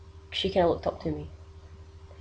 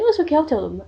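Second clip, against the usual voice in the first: higher, louder breezy aunt reassurance; reassuring aunt; 8 semitones higher, 7 dB louder, faster than usual. Retold or imitated. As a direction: brush off the worry cheerfully. Aunt offering to tell kids.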